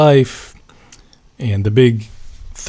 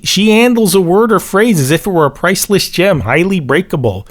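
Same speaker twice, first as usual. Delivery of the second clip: the mechanic reads "handles" and "gem" higher, in a hammed-up Chicago accent; mock Chicago accent; 7 semitones higher than usual. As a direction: read the liner note like a Chicago mechanic. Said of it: "a Chicago accent".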